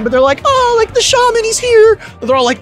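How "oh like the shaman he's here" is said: high, breathless starstruck voice; mock gushing guest. Imitating guests thrilled that the shaman has arrived, high and starstruck. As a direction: gasp it out like a devoted fan.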